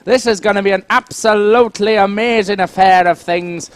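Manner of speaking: put-on Irish accent, building excitement. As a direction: cast it in a broad Irish accent. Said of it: "managed to pull that one off".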